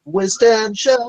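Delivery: slow, drawn-out high call of the name; teasing call of a name; mock call. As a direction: sing the name out slowly and high. Repeats the call.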